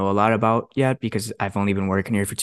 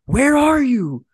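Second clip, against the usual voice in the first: much higher, slow, loud demand; mock angry boss; 11 semitones higher, 3 dB louder, slower than usual. Boss calling again, irate about waiting, high and slow. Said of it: "He's talking to me like that".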